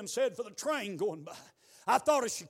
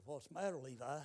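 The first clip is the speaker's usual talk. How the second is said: much softer, lowered voice; gently concerned; 7 semitones lower, 12 dB quieter than usual. Retold or imitated. Preacher asking downcast Levi what's wrong, retold in a hushed voice. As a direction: ask it carefully, very quietly. Asks boy who dropped head.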